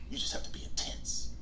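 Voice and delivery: whispered, intense advice; soft and intense. Shown as a demonstration. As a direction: drop to a whisper but keep it intense. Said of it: "I have to yell".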